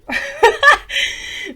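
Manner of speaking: high, loud burst of laughter; real laugh, high and sudden. Real, not acted. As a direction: crack up at your own silly accent. Laughs at own accent attempt.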